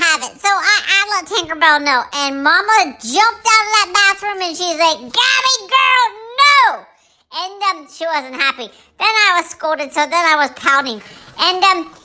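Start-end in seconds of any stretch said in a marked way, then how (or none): 5.0-6.9 stern, higher, drawn-out telling-off; mock scolding. Mama jumps out of the bathroom scolding Gabby, mock stern shout. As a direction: scold the pet with a raised, firm no. Mom scolding the pet in a retold story.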